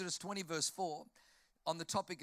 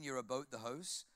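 "about the house" as Canadian "a-boat the hoase"; mock Canadian vowels; Canadian accent; 2 semitones lower than usual. Shown as a demonstration. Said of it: "'Cause in Canadian".

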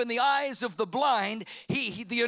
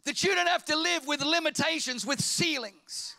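loud, climbing preacher's cry; preaching at full force; 4 semitones higher, 4 dB louder than usual. Acted. Pastor rousing the church to live above limits, full-force preaching. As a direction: lift the crowd with a shouted promise.

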